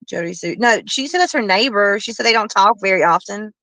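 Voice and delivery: ordinary talk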